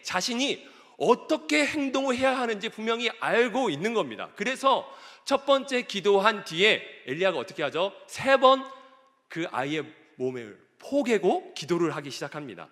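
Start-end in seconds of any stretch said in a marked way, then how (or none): none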